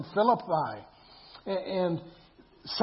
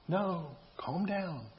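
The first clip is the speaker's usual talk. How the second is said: slow, softer, soothing self-talk; calming down; 7 dB quieter, slower than usual. Acted. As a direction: talk yourself down gently. Soothing himself amid the rant.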